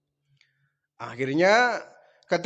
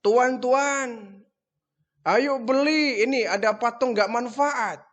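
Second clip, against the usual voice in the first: higher-pitched hawker's cry to passers-by; street hawker calling; 6 semitones higher than usual. Acted. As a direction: call out your wares across the village. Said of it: "Nabi Ibrahim teriaknya".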